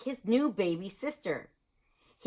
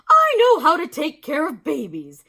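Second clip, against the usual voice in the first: confident teacher; 6 semitones higher, 10 dB louder than usual. A narrator's reading.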